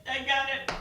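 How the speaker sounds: loud high insisting no help needed; insistent brush-off. Retold or imitated. Refusing husband's offer to drive, loud and insistent. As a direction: wave off the offer, firm and loud.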